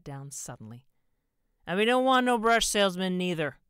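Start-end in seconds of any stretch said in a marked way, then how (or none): 1.7-3.6 put-on high, very loud drunken holler; belligerent bellow. A narrator's reading. Drunk husband from the sofa about the salesman, bellowing. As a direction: bellow it all out in one go.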